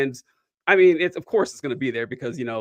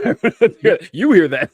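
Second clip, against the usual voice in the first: a real laugh breaking into the words, higher and louder; 6 semitones higher, 9 dB louder than usual. Real, not acted. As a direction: laugh it out mid-sentence. Laughing at the compliment idea.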